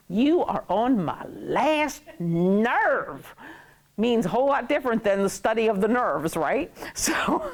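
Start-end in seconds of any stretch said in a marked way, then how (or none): 0.1-3.3 very slow, word-by-word warning; hammed-up end-of-my-rope delivery; fed-up exasperation. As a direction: stretch each word, patience used up. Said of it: "words are used expressively".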